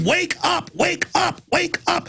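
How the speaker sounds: high, pleading repeated cry; urgent wake up call. Retold or imitated. Host urging his audience to wake up, high and pleading. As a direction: rouse sleeping listeners, high and urgent. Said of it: "I'm jumping up and down going".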